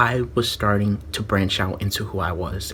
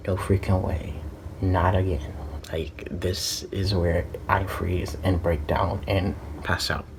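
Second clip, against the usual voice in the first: dread; 3 semitones lower, 6 dB quieter than usual. Retold or imitated. Dreading the speech in class.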